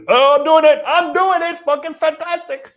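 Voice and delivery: very high, loud mock-euphoric cries; over-the-top triumphant falsetto; hammed-up elation. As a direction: squeal it like you're carried away by the workout. Acting himself fired up mid workout.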